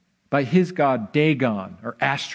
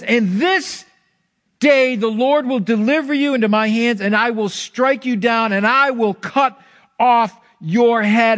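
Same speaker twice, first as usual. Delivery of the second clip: higher, louder, bold battle cry; fired-up declaration; 6 semitones higher, 4 dB louder than usual. Acted. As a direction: proclaim it like a fired-up young warrior. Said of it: "He's fired up".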